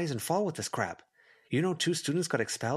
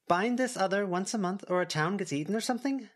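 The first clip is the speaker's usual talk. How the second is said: put-on a bit higher, curious young girl asking; lightly voiced girl Verona; 7 semitones higher than usual. A narrator's reading. Verona asking the group about binding, lightly higher girl voice. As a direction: ask it like a wide-eyed kid.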